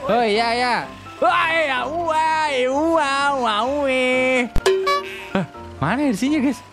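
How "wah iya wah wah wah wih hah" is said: normal talking voice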